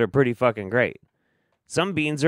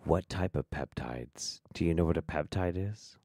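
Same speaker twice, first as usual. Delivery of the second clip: muttered aside; 8 semitones lower, 9 dB quieter than usual. Acted.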